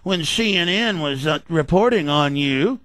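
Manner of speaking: slow, drawn-out mock doubter; mock suspicious listener. A radio host mimicking a doubting listener, slow mocking whine. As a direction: mimic a listener's misgivings, dragging the words. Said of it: "and then you go".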